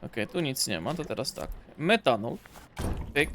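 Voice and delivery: ordinary talk, nothing marked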